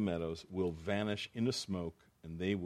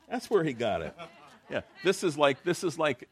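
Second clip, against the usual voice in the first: chuckling through the joke about Jesus borrowing; a real laugh breaking into the words; 8 semitones higher, 7 dB louder than usual. Real, not acted. Preacher laughing at his own joke, chuckling.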